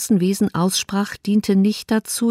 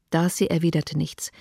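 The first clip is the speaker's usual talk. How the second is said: plain reading voice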